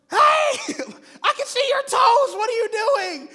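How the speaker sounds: high, full-volume mock scream; exasperated yell. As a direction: yell through a door, hammed-up disbelief. Yelling at feet behind the door.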